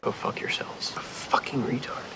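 hissed curses under breath; whispered swearing. Acted. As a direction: whisper the insults.

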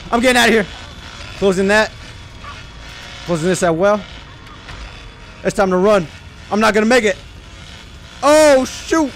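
fleeing the killer in panic; being chased. Real, not acted. Killer chasing, running away.

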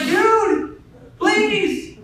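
high, loud, drawn-out exasperated plea; comically fed-up parent, half laughing; exasperated dad. Acted. Exasperated parent begging kids for patience.

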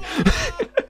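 squeaky burst of laughter; high squealing laugh. Real, not acted. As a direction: let a high laugh escape.

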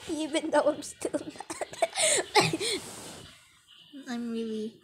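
usual speaking voice

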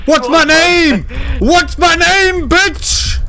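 high, drawn-out victory yell; taunting shout. Pretend. Bulletproof hero taunts beaten foe, triumphant shout. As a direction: demand it loudly, stretching the last words.

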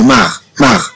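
مار said in a single beat, not split; one-beat syllable. Shown as a demonstration. Teacher showing pupils مار as one syllable. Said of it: "أنه تتنطق دفعة واحدة".

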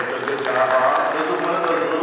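a booming shout-out to Bob across the room; loud praise. Real, not acted.

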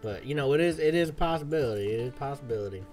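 banter like a cowboy on the trail; cowboy accent. Pretend. Streamer talking to Lenny and chat, cowboy accent. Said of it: "cowboy accent, it ain't too hard for me".

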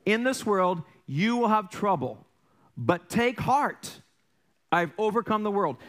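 preaching the verse slowly; slow emphatic scripture. Shown as a demonstration. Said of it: "That's found in John sixteen thirty-three".

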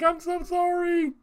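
imitating a high, loud, pleading apology; mock apology. Pastor apologizing to the crying baby, hammed-up. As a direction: squeal an exaggerated sorry.

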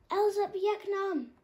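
loud, high, slow magic shout; cried out. A narrator's reading. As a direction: shout the spell together. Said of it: "everyone cried".

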